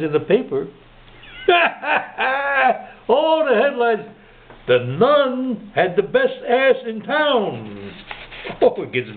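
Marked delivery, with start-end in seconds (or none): 1.4-2.8 laugh. Real, not acted. Teller laughs at own joke. 4.7-7.9 drawn out, pitched up like a headline; slow, higher punchline headline. Acted. Newspaper headline punchline read out.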